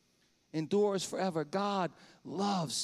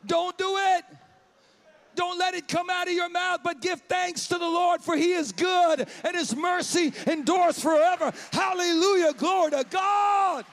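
much higher and louder shouted praise; preaching at full force; 8 semitones higher, 6 dB louder than usual. Real, not acted. Preacher shouting praise to the congregation at full force. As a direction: preach it out at the top of your voice.

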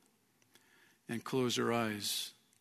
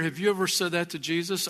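normal talking voice

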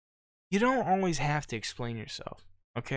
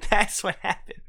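ordinary delivery

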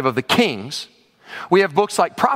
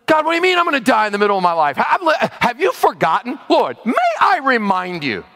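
a hint of an indignant self-righteous king; mock entitled complaint, higher pitched; bargaining with God; 6 semitones higher than usual. Preacher imitating Hezekiah reminding God of his goodness, mildly indignant. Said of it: "he's in bargain mode with God".

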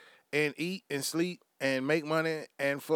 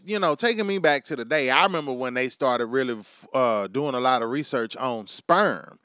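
ordinary talk

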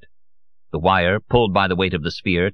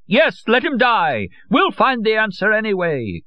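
frenzied cry; 3 dB louder than usual. A narrator's reading. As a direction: cry out carried away. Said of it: "cried Madame Olcott, equally carried away".

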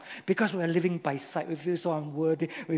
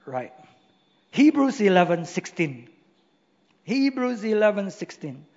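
normal talking voice

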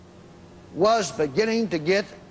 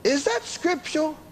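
higher-pitched sceptical question; pitched-up imagined objector; doubting listener; 7 semitones higher than usual. Acted. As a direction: put the doubter's question in a raised voice. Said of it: "You might say".

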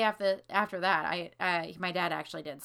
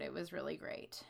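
whispered; 7 dB quieter than usual. Real, not acted. Fond memory, voice drops.

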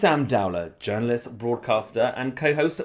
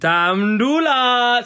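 drawn-out announcer; 12 semitones higher, 5 dB louder, much slower than usual. Acted. Stretched mock announcer.

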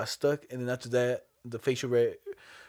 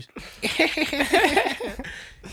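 high teasing laugh, imitating the friend who shot you; mock teammate cackle; 12 semitones higher, 3 dB louder than usual. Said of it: "and I hear".

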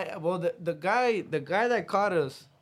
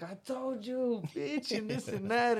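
scolding; 8 semitones higher, slower than usual. Retold or imitated. Telling cousin off after cop saw.

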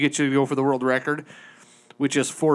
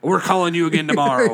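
louder, curt telemarketer voice; mock pissy telemarketer; 3 semitones higher, 5 dB louder than usual. Imitating the offended telemarketer, loud and curt. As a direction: snap back like a sore caller.

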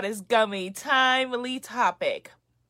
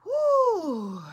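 high loud cheering woo; excited whoop; 10 semitones higher, 3 dB louder than usual. Real, not acted. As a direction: whoop for the crowd. Cheering for protesters in Houston.

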